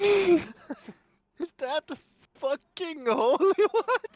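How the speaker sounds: high, loud, laughing through the words; can't finish the question for laughing; cracking up mid-sentence in shock; laughing disbelief. Real, not acted.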